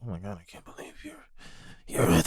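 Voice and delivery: mock breathless fan whisper; starstruck whisper. Imitating a fan who stares on the street, starstruck whisper. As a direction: whisper it fast, like a stunned fan.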